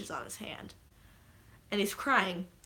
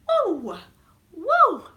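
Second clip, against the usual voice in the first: loud, high mock wail; two short falling wails; re-enacted shocked cry; 10 semitones higher, 10 dB louder than usual. Re-enacting herself in the theater, loud shocked wail.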